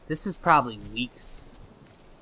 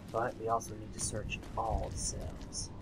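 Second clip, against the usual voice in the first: whisper; 7 dB quieter than usual. Real, not acted. Aside while sneaking in game.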